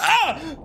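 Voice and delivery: high loud sudden ah; startled scream. Real, not acted. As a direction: yelp in surprise. Startled by a bass.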